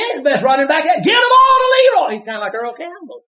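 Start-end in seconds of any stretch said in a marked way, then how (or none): 0.0-2.2 fans hollering. Retold or imitated. Fans yelling at the coach for Leroy. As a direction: yell it like a fan. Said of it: "They just kept hollering".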